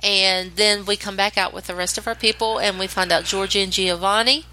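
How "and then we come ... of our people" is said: ordinary talk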